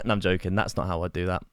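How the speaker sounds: ordinary talk, nothing marked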